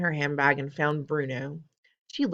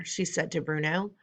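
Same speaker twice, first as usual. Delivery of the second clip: usual speaking voice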